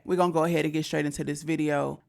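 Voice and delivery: usual speaking voice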